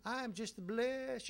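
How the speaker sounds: mock blessed gushing. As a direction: imitate an over-blessed church visitor. Imitates visitor gushing in his office.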